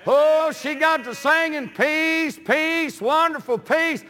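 preaching. Real, not acted. Preacher telling of trapping friends with gospel songs, preaching. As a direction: preach the story with rising fervor.

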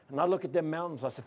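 ordinary delivery

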